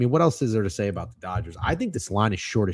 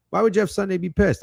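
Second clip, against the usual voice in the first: louder, insistent rhetorical question; louder repeat; 10 semitones higher than usual. Shown as a demonstration. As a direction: push it harder the second time.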